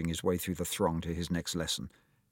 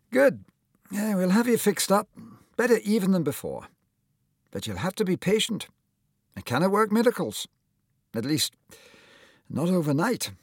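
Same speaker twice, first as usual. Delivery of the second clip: put-on higher, louder, hearty old surgeon voice; lightly voiced cheery doctor; kindly old surgeon; 11 semitones higher, 4 dB louder than usual. A narrator's reading. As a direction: play the jolly surgeon greeting his patient. Surgeon reassures Tom.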